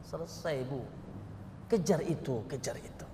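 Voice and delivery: normal talking voice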